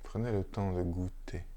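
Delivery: unhurried, hushed meditation guidance with long silences; guided meditation. Real, not acted. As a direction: lead listeners gently into stillness, phrase by phrase. Guide une méditation après la lecture. (méditation guidée, posée, entre de longs silences)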